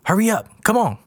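high, urgent half-yell; yelled urging without much volume; mock yell. A narrator's reading. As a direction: hurry them along in a high voice. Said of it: "Bessie yells to Qualis".

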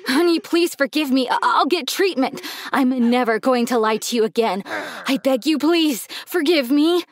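urgent begging, stumbling over promises; desperate pleading. Acted. Dad begs his daughter to forgive him, desperate pleading. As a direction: beg earnestly for forgiveness.

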